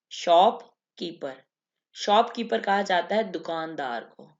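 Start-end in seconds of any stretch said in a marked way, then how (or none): 0.1-1.4 slow, deliberate repetition; slow drill. Shown as a demonstration. Teacher slowly repeating shopkeeper for the class. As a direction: say shopkeeper slowly so students catch it.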